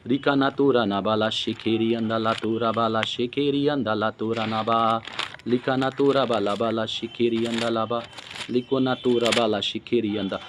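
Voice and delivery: low, even, rolling chant of syllables; praying in tongues. Real, not acted. Preacher praying in tongues mid-sermon, low and monotone.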